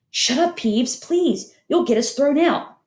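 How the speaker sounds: urgent hushed begging; pleading. Acted. Begging Peeves to be quiet.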